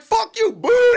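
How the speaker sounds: high, loud taunting shout; mock yell back. Comic shouting back at a booing crowd, high loud taunt. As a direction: fling it at the crowd.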